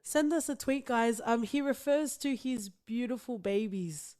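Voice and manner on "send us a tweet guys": ordinary talk, nothing marked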